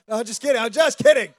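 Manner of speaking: loud laughing no no, just kidding. Real, not acted. Pastor laughing to the congregation he's only joking. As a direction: laugh off the joke, high and loud.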